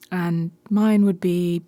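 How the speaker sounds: normal talking voice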